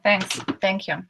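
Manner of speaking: ordinary talk, nothing marked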